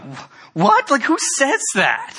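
high, incredulous mock wife voice; wife's shocked reaction. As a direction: play the stunned wife. Wife reacting to his statement.

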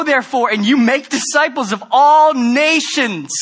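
loud, high, fervent preaching voice voicing Jesus; preaching at full force. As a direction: proclaim it like a commission from heaven.

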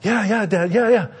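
put-on high, rushed kid voice promising; eager kid promise. Son quickly promises dad to stop, high kid voice. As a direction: squeak out yes like a caught kid. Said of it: "You gonna stop bugging your sisters?".

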